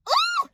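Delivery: short high squeal of delight; joyful squeal. Real, not acted. Overwhelmed by the job news, a joyful squeal. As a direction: let out a sudden happy shriek.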